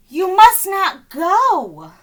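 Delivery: loud, slow urgent plea; pleading Yoda. A narrator's reading. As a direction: beg him slowly not to go. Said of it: "Yoda pleads".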